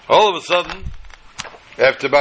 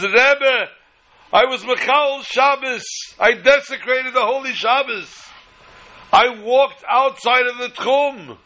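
desperate confession; 8 semitones higher, slower than usual. Acted. Bursts in confessing to the Rebbe.

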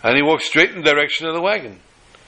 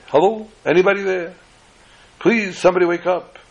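pleading knock-on-doors call; 6 semitones higher than usual. Retold or imitated. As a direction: call into the dark, hoping someone wakes. Traveler calling at dark doors.

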